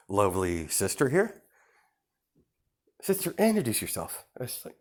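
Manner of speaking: high, oily polite villain voice; a charming, sly host welcoming guests; smug villain host. Acted. Plays villain Izuku throughout.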